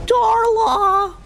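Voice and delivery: ordinary talk, nothing marked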